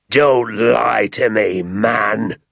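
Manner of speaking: slow, loud angry yell. A narrator's reading. Landowner yelling at the father over pheasants, loud and slow. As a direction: bellow it at him like a charging bull. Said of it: "yelled Mister Hazel".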